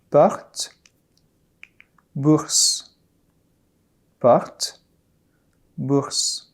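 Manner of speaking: porte and bourse with a soft throat r; soft French r. Shown as a demonstration. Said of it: "Let's repeat".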